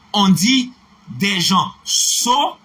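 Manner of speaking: "des gens sots" slowly, stress on dit; teacher's slow example; slow model phrase. Shown as a demonstration. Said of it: "noté cette règle".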